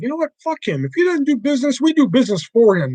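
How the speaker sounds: lightly voiced wrestling boss laying down the law; Triple H quote. Streamer quoting his favorite wrestler line, hammed up. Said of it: "one of my favorite quotes from Triple H".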